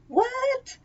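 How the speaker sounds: very high, squeaky what; shocked disbelief. Real, not acted. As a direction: shoot way up in pitch, incredulous. Escalating disbelief at the date.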